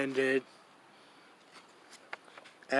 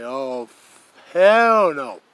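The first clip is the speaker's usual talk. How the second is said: emphatic retold refusal; 14 dB louder, slower than usual. Retelling the young man's answer, loud and emphatic.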